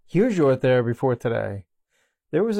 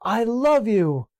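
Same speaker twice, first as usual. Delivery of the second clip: high, slow heartfelt call; shouting out; 8 semitones higher than usual. Retold or imitated. Child shouts his love into the woods, slow high call. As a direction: call it out slowly across the woods. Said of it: "shout out".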